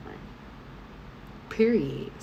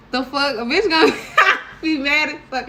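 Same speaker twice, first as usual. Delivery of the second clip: high, loud laughing through the words; can't stop laughing while telling it; 10 semitones higher, 8 dB louder than usual. Real, not acted.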